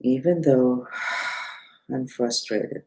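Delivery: slow, soft mantra chant; mantra recitation. Shown as a demonstration. Teacher leading viewers through the mantra, slow soft chant. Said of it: "I'm gonna say it three times out loud".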